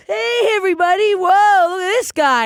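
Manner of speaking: high-pitched tearful wail, hammed up; mock crying stage greeting. Comic greeting the college crowd while crying, hammed-up sobbing cheer. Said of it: "I walked on stage crying".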